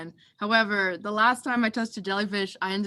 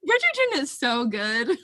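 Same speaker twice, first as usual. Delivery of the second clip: laughing; 4 semitones higher than usual. Real, not acted. Laughing defense of show.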